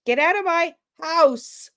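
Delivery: high, light and tentative. Shown as a demonstration. Coach contrasting a tentative homeowner, high pitched. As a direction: say it up high, unsure. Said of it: "is less confrontational than".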